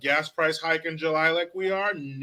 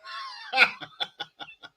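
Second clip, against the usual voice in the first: shrieking laugh; 3 dB quieter than usual. Real, not acted. Laughs at own shout.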